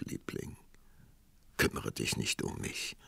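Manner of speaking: soft, voiceless near-whisper; hushed. A narrator's reading. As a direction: breathe it out gently, resigned. Poor prince, tender resignation.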